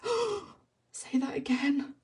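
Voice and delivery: high-pitched retold gasp of delight; delighted reaction. Woman retelling her delight at carpet fitter's accent, delighted reaction. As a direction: beg for a repeat, thrilled, voice lifted.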